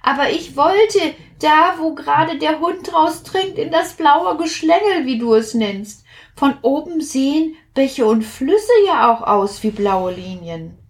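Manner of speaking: high little drop voice. Acted. Plitsch explains his wish.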